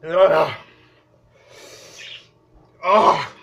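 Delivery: strained moans and gasps; gagging groans. Real, not acted. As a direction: fight not to gag. Struggling with cinnamon in mouth.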